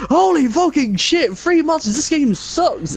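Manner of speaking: high, slow, screamed outburst; screaming streamer. Retold or imitated. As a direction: shriek it like a panicking gamer.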